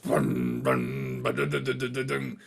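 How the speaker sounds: vocalised low riff, rolling into quick syllables. Retold or imitated. Bandmate fan shows how he likes Korn, imitated riff. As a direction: mouth the chugging guitar riff. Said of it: "I like Korn when it's".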